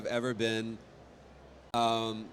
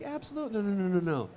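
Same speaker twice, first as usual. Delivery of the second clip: much higher, mock-panicked no no no; squeaky refusal; 9 semitones higher, faster than usual. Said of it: "I was like".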